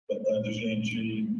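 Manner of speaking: slow, echoing, delayed copy of the voice; cathedral echo. Real, not acted. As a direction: voice bouncing back from a big hall. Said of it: "tá com eco".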